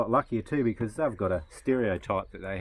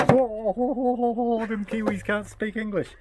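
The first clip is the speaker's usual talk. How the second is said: mocking Australians; 12 semitones higher than usual. Australians jeering that Kiwis can't speak English, mocking. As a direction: imitate someone gleefully jeering, pitched way up. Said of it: "and they go".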